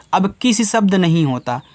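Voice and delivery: normal talking voice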